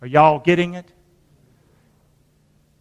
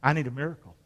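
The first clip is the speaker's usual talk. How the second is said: quiet plea; 7 semitones lower, 12 dB quieter, faster than usual. Real, not acted. Quiet confession.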